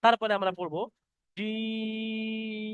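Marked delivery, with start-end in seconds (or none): 1.4-2.7 জিম drawn out very long, pressed and thick; long jim. Shown as a demonstration. Teacher models long stretched jim for online students. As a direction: hold জিম for three beats. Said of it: "তিন আলিফ পরিমাণ টান দিতে হবে".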